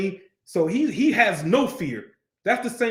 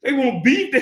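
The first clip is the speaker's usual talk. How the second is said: raised, playful confrontation; higher-pitched, mock fighting talk; street-slang challenge; 5 semitones higher, 4 dB louder than usual. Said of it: "So how we would say it is".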